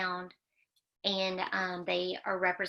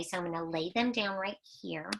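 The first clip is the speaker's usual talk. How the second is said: whisper; 7 dB quieter, faster than usual. Real, not acted. Aside while arranging strips.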